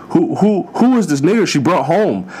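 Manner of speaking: appalled father meeting daughter's date; outraged dad. Retold or imitated. Imagined shocked racist dad.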